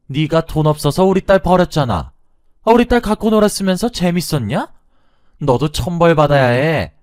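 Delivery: high, loud tirade of accusations; brazen angry shouting. Acted. Mother-in-law publicly accusing her daughter's fiancé, brazen furious shouting. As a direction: shout it shamelessly, far above normal pitch. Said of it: "더욱 뻔뻔했습니다".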